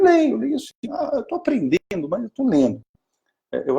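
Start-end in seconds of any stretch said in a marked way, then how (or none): 0.0-0.7 put-on high, eager little girl's answer; a child proudly answering the visitor; child's voice. Child answering about reading. 0.9-2.8 higher, lightly voiced young girl; a little girl telling the visitor she is learning; child's voice.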